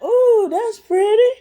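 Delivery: put-on high, slow girly coo; wide-eyed high sigh of wonder; mock dreamy awe. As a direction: gush over the pretty sight in a high voice. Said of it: "I'm like".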